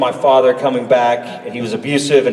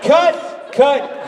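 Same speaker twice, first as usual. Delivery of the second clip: high-pitched imitation of a crew call; mock calling cut; 13 semitones higher than usual. Crew stopping the scene, high mock call.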